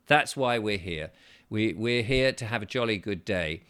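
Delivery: normal talking voice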